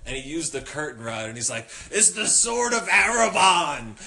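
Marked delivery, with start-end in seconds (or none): 1.9-3.9 loud, high, slow drawn-out proclamation; mock-heroic battle cry. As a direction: declaim it like brandishing a sword. Roommate wielding curtain rod, acted out.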